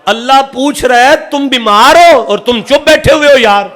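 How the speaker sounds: high, loud sermon shout; fiery preaching. Acted. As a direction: thunder it at the crowd. Preaching at full force.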